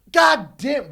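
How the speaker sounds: loud retold yell at a daughter; mock angry yell. Golf dad yelling at his crying daughter, loud mock anger. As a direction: bark it like a furious golf dad. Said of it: "he was yelling at her".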